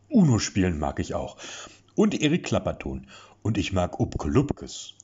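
put-on big baby voice. A narrator's reading. Lasse introducing himself to Erik in a big baby voice. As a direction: talk like an overgrown toddler. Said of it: "schwafelte Lasse unbeirrt weiter".

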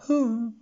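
high, loud whimpering hum; sad whimper. A narrator's reading. Beast master grieving his pet, sad whimper. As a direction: whimper over the dead pet. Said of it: "winselte der Herr der Menagerie traurig".

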